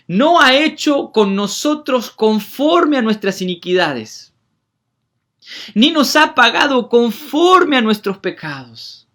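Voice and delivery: slow, loud, high proclamation; preaching at full force. Acted. Preacher proclaiming God's mercy to the church, slow and loud. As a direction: thunder it out slowly.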